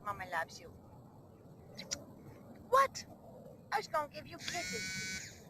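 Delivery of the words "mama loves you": low, soft loving murmur; tender. Real, not acted. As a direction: soothe the bird gently. Soothing the pet.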